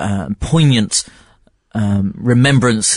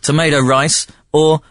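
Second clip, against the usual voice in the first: ordinary talk, nothing marked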